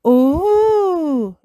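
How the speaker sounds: high, loud, long ooh; amazed children. Acted. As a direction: marvel like a group of kids. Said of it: "say the children".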